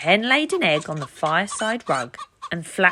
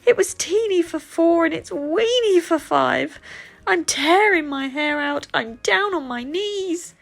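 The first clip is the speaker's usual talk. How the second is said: frantic cry; 10 semitones higher, 5 dB louder than usual. Acted. Old lady wails at the dancing cow, very high and loud. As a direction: wail like you're at the end of your rope. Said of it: "The little old lady cried".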